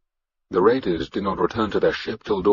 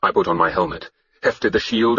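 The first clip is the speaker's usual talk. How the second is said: ordinary delivery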